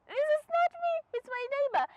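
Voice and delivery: very high, squealed comic protest; high-pitched mock denial. Embarrassed driver blaming the neighbour, high-pitched squeal. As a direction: squeal it, disowning the blunder.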